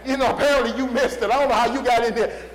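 loud, laughing; laughing joke. Acted. Preacher joking about getting into heaven, laughing. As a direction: chuckle through the joke.

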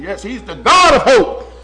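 ordinary talk, nothing marked

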